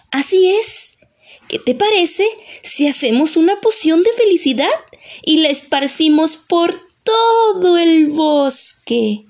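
high fairy voice, stretching the last words; eager fairy. Acted. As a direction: propose a magic plan as a fairy, pitched high. (hada entusiasta, voz aguda)

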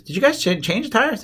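high, imitated wife's query; puzzled questioning. Wife questions the tire shop, high and puzzled. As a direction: pitch way up, checking in disbelief.

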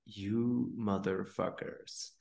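hushed, slow grumbled curse under the breath; muttered curse. Retold or imitated. Retelling meditation, swearing at neighbors in a hushed voice. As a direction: curse the neighbors quietly, slowly. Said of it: "And I'm like".

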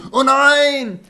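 high mock cry of alarm. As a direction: squeal it in hammed-up shock. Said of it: "ist nur so".

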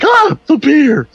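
high, loud, stretched-out panicked cry; mock scream. Homer panics over the beer, mock screaming. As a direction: wail it as the beer explodes. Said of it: "and he screams".

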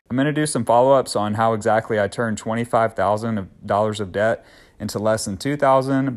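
normal talking voice

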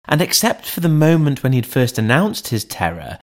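ordinary delivery, nothing marked

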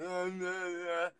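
slurred mumbling uh; drooling groan. Retold or imitated. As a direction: mumble it like a dazed patient. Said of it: "My mouth was going".

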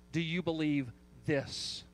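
"this" set apart after a pause; stress on THIS. Shown as a demonstration. Preacher explaining Jesus' precise question, stress on THIS. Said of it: "final phrase that may be emphasized here is this".

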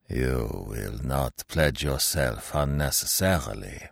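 low, slow, uncanny echo; sinister slow murmur, low; eerie stranger voice. Acted. Vanderhausen echoes Dow's thought.

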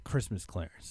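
ordinary talk, nothing marked

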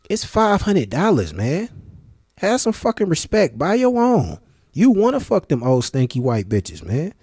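high-pitched indignant rant; exasperated scolding. Real, not acted. Scolding people who beg for consoles.

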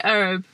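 "herb" as 'erb, no h; American-style "herb", starting on the vowel; American silent h. Shown as a demonstration. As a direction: drop the h in "herb". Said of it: "in America, don't they say herb?".

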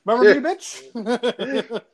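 drawn-out, high laughing taunt; pretend taunting. Former pupil gloating over old teacher, playfully taunting.

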